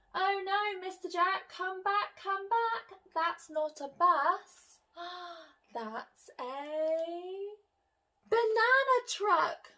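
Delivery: high alarmed call. Acted. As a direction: call him back urgently. Miss Jones calling again.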